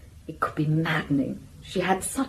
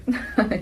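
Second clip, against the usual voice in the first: short laugh; 5 semitones higher, 3 dB quieter than usual. Acted. Laughs at the money remark.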